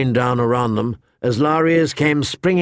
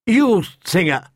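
higher call across the camp; 6 semitones higher than usual. A narrator's reading. Knight calls the singer over, raised call. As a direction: shout to get his attention. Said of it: "Sir Willis Wode called out".

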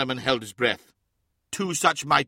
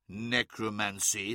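slow, softer scornful scoff; 4 semitones lower, 6 dB quieter, slower than usual. A narrator's reading. Magnus scoffing at Russ's accusation, slow and softer. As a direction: sneer the word out slowly, dismissing it. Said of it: "scoffed Magnus".

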